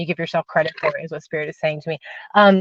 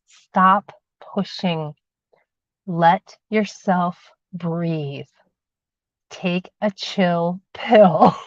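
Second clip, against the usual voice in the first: slowed right down; much slower than usual. Acted. Calming the viewer down.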